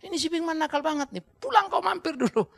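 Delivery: high mock exasperated mother; mother on phone. Mother phoning aunt about naughty son, high mock plea. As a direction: call for help like a tired mom. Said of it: "telepon dia".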